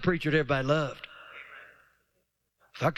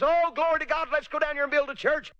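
much higher, fast, gushing churchgoer; mock enthusiasm; 8 semitones higher than usual. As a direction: sneer at the fake eager believer. Mocking eager churchgoers.

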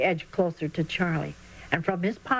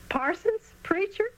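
amused; 13 semitones higher than usual. Acted. Preston laughs at the name.

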